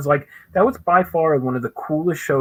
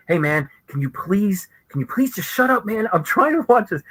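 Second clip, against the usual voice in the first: exasperated plea; 6 semitones higher than usual. Retold or imitated. Fan to man on the phone during a panel, exasperated. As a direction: act out pleading with a noisy stranger, fed up. Acts out telling stranger to shut up.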